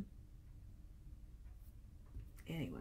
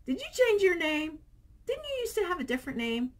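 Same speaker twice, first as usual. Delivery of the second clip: high, quick surprised questions; asking a commenter about their new name, surprised; 11 semitones higher, 4 dB louder, faster than usual. Real, not acted. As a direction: ask in genuine surprise.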